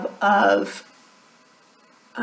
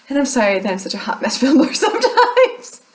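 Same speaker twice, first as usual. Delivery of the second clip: real laugh bubbling into the words; laughing apology; 4 semitones higher than usual. Real, not acted. Apologizing to viewers for messy filming, laughing. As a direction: apologize while cracking up, pitch climbing.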